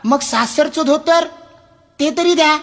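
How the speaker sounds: high, whiny request; pleading customer. Acted. Customer begs the librarian, high and whiny. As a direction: wheedle for any book at all.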